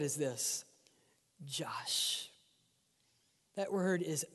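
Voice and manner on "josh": whispered name. Pretend. God calling the pastor by name, low whisper.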